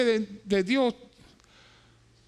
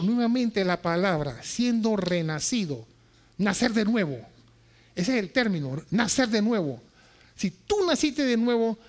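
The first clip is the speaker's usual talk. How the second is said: normal talking voice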